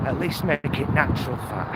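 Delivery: usual speaking voice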